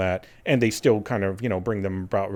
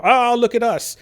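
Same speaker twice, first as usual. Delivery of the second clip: mock showing off; 12 semitones higher, 5 dB louder than usual. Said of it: "trying to".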